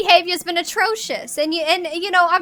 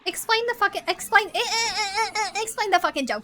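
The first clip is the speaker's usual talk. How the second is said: put-on nasal New York nanny accent; Fran. Nanny grilling someone about her client, nasal New York accent. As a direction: talk out your nose like a New York nanny. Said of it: "bringing a certain Fran".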